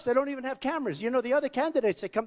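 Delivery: ordinary delivery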